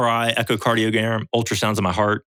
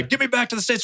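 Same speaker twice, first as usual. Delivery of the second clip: high, strained panicky voice; panicked plea; 14 semitones higher than usual. Retold or imitated. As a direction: squeak it out like a scared patient. Panicked patient quotes himself.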